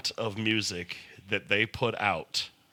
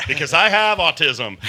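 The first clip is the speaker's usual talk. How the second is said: ordinary talk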